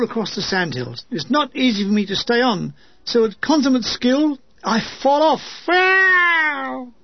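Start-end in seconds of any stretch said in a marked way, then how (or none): 5.7-6.9 falling yelp. Acted. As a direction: yelp as you tumble off. Falls off the carrier.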